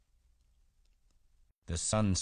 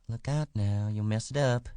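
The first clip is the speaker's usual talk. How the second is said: higher woman's voice, warning; uneasy wife's warning; 7 semitones higher than usual. A narrator's reading. Wife warning the man stroking her hair, uneasy. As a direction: warn him off in a raised pitch.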